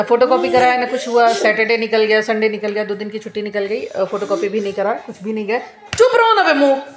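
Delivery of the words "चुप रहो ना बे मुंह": sudden high-pitched scolding; irritated hushing. Real, not acted. Mother breaks off her story to hush her child, irritated. Hushes child mid-recording.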